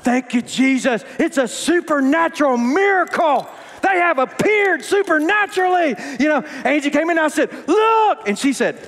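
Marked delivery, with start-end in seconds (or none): 0.0-6.0 hammed-up revival-style jubilation, climbing higher; jubilant praise. Retold jubilant thanks for a miracle. 7.7-8.3 high, excited cry; triumphant exclamation. Retold or imitated. Retold calling wife to see find.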